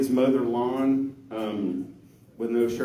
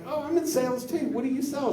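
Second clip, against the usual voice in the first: much higher, fast and eager; mock eager man; 13 semitones higher, faster than usual. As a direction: play him as a keen salesman, pitched up. Eager man voiced in joke.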